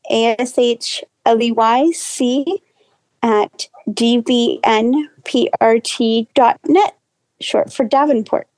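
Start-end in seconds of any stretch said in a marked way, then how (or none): 0.1-7.0 slow, careful spelling out; slowly dictating an email address; slow enough to write down. Real, not acted. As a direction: spell it letter by letter, unhurried. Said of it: "I'll try to say it slow enough".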